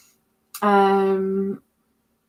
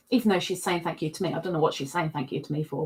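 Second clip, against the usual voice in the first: usual speaking voice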